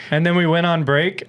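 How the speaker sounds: ordinary delivery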